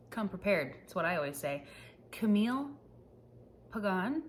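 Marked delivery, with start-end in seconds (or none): 3.7-4.2 Pagan as puh-GAHN, rising questioningly; unsure pronunciation attempt. Shown as a demonstration. Vlogger guessing an author's accented surname, Pagan as puh-GAHN. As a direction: stress the second syllable of Pagan, with an AH. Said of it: "there's an accent over the second A".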